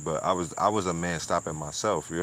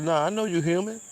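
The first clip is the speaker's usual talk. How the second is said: quoting the pastor; 7 semitones higher than usual. Retold or imitated. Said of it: "He just said".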